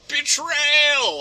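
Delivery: high, loud dramatic cry; hammed-up drawn-out exclamation; mock outcry. Mocking an overblown movie voice, high loud cry. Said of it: "I never was like".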